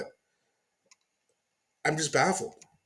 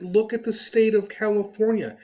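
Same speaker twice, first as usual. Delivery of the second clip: angry rant; 7 semitones higher, 4 dB louder than usual. Real, not acted. Ranting at Newsom clip.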